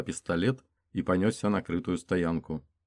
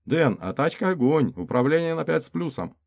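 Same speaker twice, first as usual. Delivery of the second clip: character voice; 9 semitones higher, 5 dB louder than usual. Acted. Driver's joke, higher voice.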